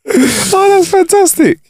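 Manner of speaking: high delighted laugh. Real, not acted. Friend still laughing and praising the joke, high and delighted. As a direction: laugh then gush.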